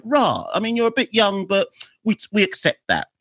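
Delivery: high-pitched, mildly put-on response; mock public reaction. Fans reacting to a player's retirement, high-pitched acted acceptance. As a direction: answer as the public, pitched well up.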